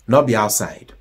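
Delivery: normal talking voice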